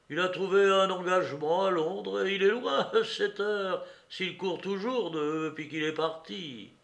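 high, loud mocking news; derisive woman. A narrator's reading. Woman says Zigzag fled to London, derisive. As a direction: tell him he's behind the times.

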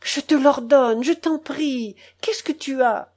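high, urgent entreaty; ordering, then pleading. A narrator's reading. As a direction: command loudly, then ease into begging.

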